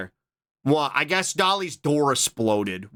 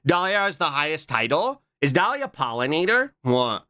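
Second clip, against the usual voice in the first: normal talking voice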